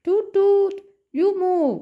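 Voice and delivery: high, lightly voiced little car; voiced red car. Red car tells the bus to move, lightly voiced high tooting. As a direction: toot and order lightly like a cartoon car. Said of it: "red car എന്താ പറയുന്നേ".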